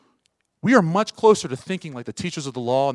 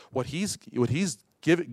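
ordinary talk

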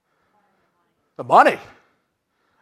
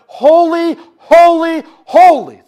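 loud high proclaiming holy; calling out; 10 semitones higher, 12 dB louder, slower than usual. Acted. Seraphim calling to one another, loud and high. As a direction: cry it out across the temple. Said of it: "they just kept calling out".

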